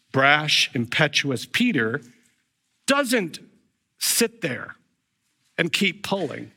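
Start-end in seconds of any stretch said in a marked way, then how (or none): none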